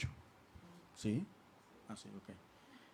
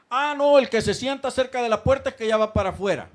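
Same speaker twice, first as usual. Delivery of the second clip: high, loud mock remark; loud, derisive imitation; 7 semitones higher, 8 dB louder than usual. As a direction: imitate someone jeering at the back-row sitters. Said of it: "lo dicen hasta como forma de burla".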